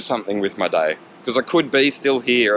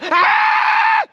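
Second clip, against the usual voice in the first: very high, loud shriek; piercing pretend scream of terror; mock horror scream; 5 dB louder than usual. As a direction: shriek like the victim in a hammed-up ghost story. Scare ending his mock ghost story.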